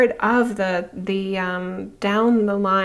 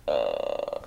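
a sudden deep grunt, imitating Jesus; low mock grunt; 8 dB quieter than usual. Jesus reacting to her with a deep grunt. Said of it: "And he's like".

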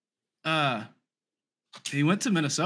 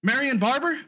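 high excited realization; 10 semitones higher than usual. Real, not acted. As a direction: blurt the name as it hits. Suddenly remembers the name.

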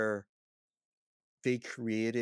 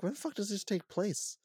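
high-pitched, exasperated bewilderment; incredulous reaction; 11 semitones higher than usual. Retold or imitated. Baffled viewer questioning when the movie takes place, incredulous. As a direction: retell your baffled outburst in a much higher voice.

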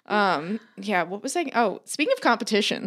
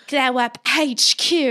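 slow, higher, louder mock British announcer accent; exaggerated British accent; 4 semitones higher, 5 dB louder, slower than usual. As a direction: ham up a British TV-show accent, drawn out. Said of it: "aggressive, horrible accents".

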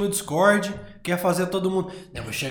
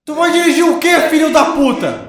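loud, high disbelieving yell; outraged shout; 8 semitones higher, 8 dB louder than usual. Real, not acted. Friend says he'll drive drunk.

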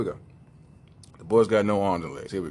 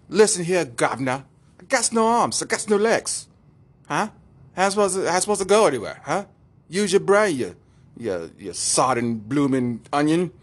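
high, indignant mock-English teenager; hammed-up English kid, spoiling for a fight; English accent; 5 semitones higher than usual. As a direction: argue back in a cheeky, high English accent. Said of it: "I wanna give him an English accent".

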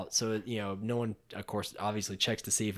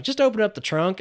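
higher, louder imitated order; mock impatient instruction, pitched up; acted urging; 7 semitones higher, 5 dB louder than usual. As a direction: urge them on, playing a character. Acts character giving an order.

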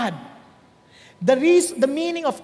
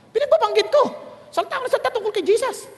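high, loud and fast, bursting with it; excited; 10 semitones higher, 4 dB louder, much faster than usual. Real, not acted. Preacher tells the congregation he preached anyway, excited. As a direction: rattle it off, thrilled.

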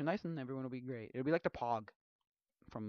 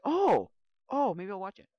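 mock surprise; 7 semitones higher, 22 dB louder than usual. Mock realization about show.

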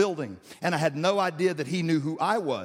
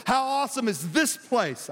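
raised, emphatic scripture quote; awed exclamation; 5 semitones higher than usual. Acted. Preacher quotes a frightened Jacob, in raised awe. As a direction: exclaim it, leaning on THIS. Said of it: "he was afraid and said".

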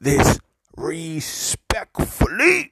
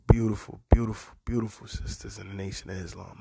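ordinary talk, nothing marked

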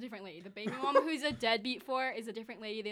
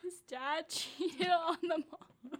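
laughing; 5 semitones higher, 6 dB louder, slower than usual. Real, not acted. Laughing while spilling gossip.

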